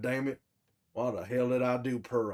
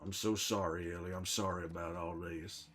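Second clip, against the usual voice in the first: soft apology; 9 semitones lower, 11 dB quieter than usual. Real, not acted. Apologizing softly to Ellie.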